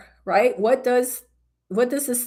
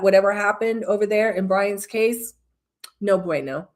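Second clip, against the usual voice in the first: ordinary talk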